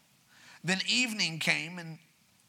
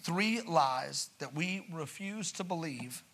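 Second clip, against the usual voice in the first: slow emphasis; 4 dB quieter, slower than usual. Shown as a demonstration. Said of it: "repeated slower for emphasis".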